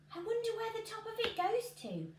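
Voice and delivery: wondering to himself. Acted. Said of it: "Jack said to himself".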